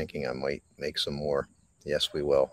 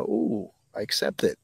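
sing-song fake brightness, pitch leaping high; sarcastic sunshine voice; a parody of instant, forced positivity; mock cheery acceptance; 8 semitones higher than usual. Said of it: "flip a switch and go".

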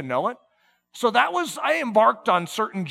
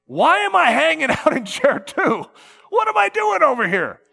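higher, louder whine; mock whining; 7 semitones higher, 6 dB louder than usual. Believer envying chair one, higher mock whine. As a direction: complain like you're missing the party.

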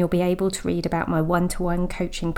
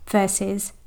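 normal talking voice

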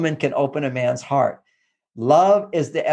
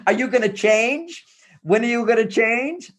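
mock nagging wife; 5 semitones higher than usual.

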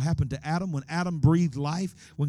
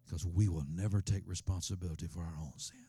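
ordinary delivery

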